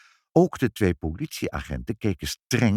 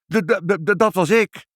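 high, loud panicky stutter; stammering; 12 semitones higher, 5 dB louder than usual. A narrator's reading. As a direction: trip repeatedly on the first sound. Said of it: "hakkelde vader".

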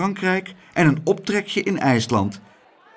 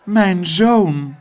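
a faint call of "Mijn zoon", raised and a little louder; weak cry; 5 semitones higher, 5 dB louder than usual. Acted. Rich lady faintly calls to Abeltje, raised and louder. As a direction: reach out and call weakly. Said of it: "riep zwakjes".

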